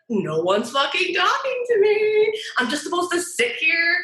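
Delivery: high-pitched exasperated complaint, pitched well up; exasperated and fed up at being ignored; frustrated rant. Retold or imitated. Reliving being ignored at work, she vents in a high exasperated rant. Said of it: "And I'm like".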